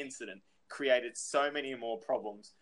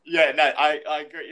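ordinary talk, nothing marked

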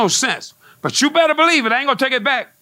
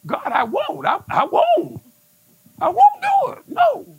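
stubborn refusal to God; 11 semitones higher than usual. Retold or imitated. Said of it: "And I was like".

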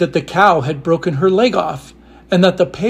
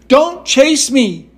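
higher, slower frightened repeat; frightened little voice; 8 semitones higher, slower than usual. A narrator's reading. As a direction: plead again, even slower. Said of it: "such a frightened little voice".

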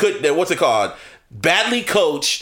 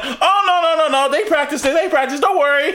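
high, excited mock commentator reassurance; a hammed-up commentator, laughing through it; imitating Romo; 9 semitones higher, faster than usual. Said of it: "Romo's going".

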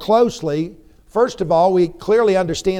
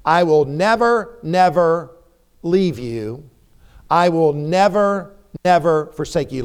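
each "never" set apart and firmly stressed; measured, hammering both "never, never"; dogmatic emphasis. Shown as a demonstration. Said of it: "making a point of dogmatic emphasis".

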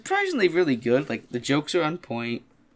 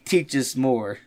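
slow, awed bro voice; drawn-out awed frat bro; much slower than usual. Retold or imitated. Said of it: "some sardines are like".

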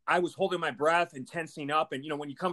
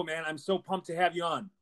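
normal talking voice

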